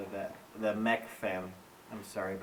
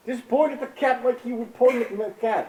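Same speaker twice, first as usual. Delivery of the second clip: high, loud, fed-up instructions; exasperated shout; 11 semitones higher, 9 dB louder than usual. Real, not acted. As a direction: yell it at a clueless partner.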